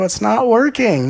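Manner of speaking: high, loud, slow complaint; frustrated whine. Retold or imitated. Seeker complaining to the speaker, high whiny frustration. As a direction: whine that nothing is working. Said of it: "you go".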